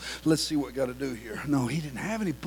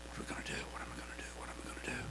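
whispered, hopeless self-talk; despairing whisper; 16 dB quieter, much faster than usual. Acted. Man with a withered hand despairs to himself, whispering. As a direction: breathe the worry as the crippled man.

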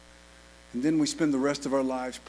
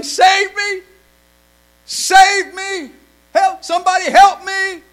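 much higher and louder cries for help; drowning man yelling; 16 dB louder, slower than usual. Retold or imitated. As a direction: shout it like a man going under. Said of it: "and he was yelling".

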